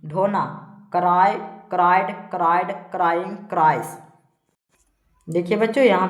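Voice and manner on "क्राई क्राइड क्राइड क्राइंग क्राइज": evenly spaced list, firm first word; verb drill recitation. Shown as a demonstration. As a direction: recite each form separately for pupils to memorise. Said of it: "इस प्रकार से सारा को पढ़ते जाना है".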